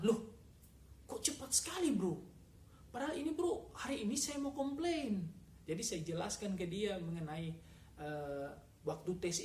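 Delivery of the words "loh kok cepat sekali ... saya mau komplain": shocked disbelief. Retold or imitated. Telling friend the registration came too fast, surprised. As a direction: voice jumps high in startled protest.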